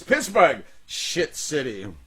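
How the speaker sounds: loud theatrical sneer, then slow; Shakespearean actor voice. Retold or imitated. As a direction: ham it up like a stage actor. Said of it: "He goes".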